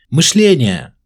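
loud, high answer; shouting. A narrator's reading. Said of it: "закричал он".

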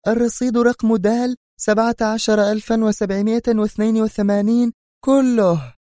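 excited high woman's voice; agitated. A narrator's reading. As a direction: demand it eagerly. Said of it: "وهي تقول في انفعال".

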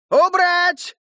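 sharp shouted order; commanding. Acted. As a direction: bark the command. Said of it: "скомандовал глашатай".

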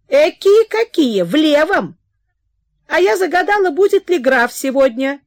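high, loud, chattering mother; excited mother. Acted. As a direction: gush about the count. (взволнованная мать, громко и высоко)

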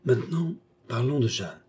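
usual speaking voice

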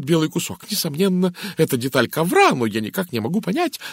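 fussy lady running on and on; chatty, flustered American lady. A narrator's reading. Narrator voices talkative Mrs Gardner.

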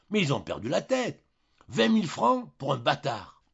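plus haut et fort, scandalisé; indigné. Acted. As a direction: cracher le mot bâtard. Julien indigné devant Jeanne. (Julien s'indigne auprès de Jeanne)

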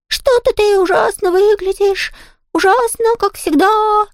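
very high, slowish falsetto taunt; high singsong. A narrator's reading. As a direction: taunt her in a singing ghost falsetto. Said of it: "пропел Том высоким голосом".

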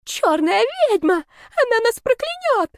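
high, piercing shriek of terror; panicked squeal. A narrator's reading. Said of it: "визжали уже в положении лёжа".

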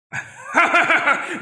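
high demonic cackle; maniacal laugh. Acted. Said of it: "Thanh niên nhe răng cười to". (cười điên dại)

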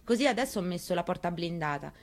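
plain reading voice

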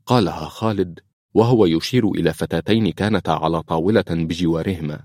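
plain reading voice

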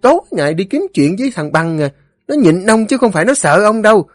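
very high, rapid nagging tirade; scolding wife. A narrator's reading. Wife scolds her drunken husband for picking fights, scolding. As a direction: berate him shrilly, barely pausing.

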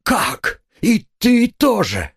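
high, drawn-out indignant questions; outraged disbelief. A narrator's reading. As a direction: round on a new betrayer. Катерина набрасывается на хозяйку. (высокий возглас возмущения)

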